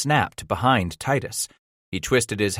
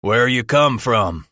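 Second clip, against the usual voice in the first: demanding; 3 semitones higher, 5 dB louder, slower than usual. A narrator's reading. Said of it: "the third man demanded".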